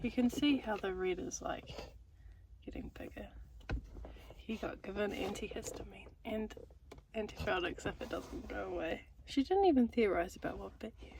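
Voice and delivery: soft hushed whisper. Real, not acted. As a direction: keep it to a breathy whisper. Whispering beside sleeping Jonathan.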